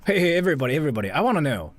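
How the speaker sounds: ordinary talk, nothing marked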